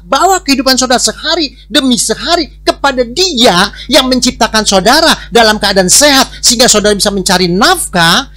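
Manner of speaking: loud, high-pitched sermon delivery; forceful preaching. Real, not acted. Preacher urging the congregation to give thanks, preaching forcefully. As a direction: drive the correction home to the congregation.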